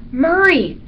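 high, loud startled cry of the name; startled dog calling to a friend; alarmed call. A narrator's reading. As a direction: call out in alarm, pitch jumping up. Dog startled by bat landing on head.

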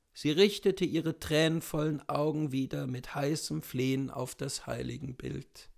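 ordinary delivery

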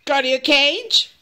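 firm order to a pet, rising on the last word; cranky scolding. Shown as a demonstration. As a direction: send the bird away sharply. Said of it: "But if I say".

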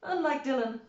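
plain reading voice

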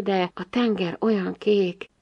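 usual speaking voice

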